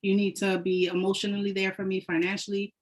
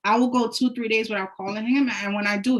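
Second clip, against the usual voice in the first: ordinary talk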